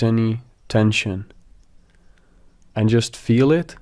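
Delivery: calm meditation guide with long pauses; guided meditation. Acted. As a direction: gently lead them into relaxation, phrase by phrase.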